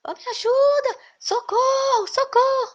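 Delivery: put-on very high, loud, frantic calls to the boy; desperate pleading scream. Priest desperately calling the boy for rescue, shrieking. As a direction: plead at the top of your voice. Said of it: "E ele ficou desesperado".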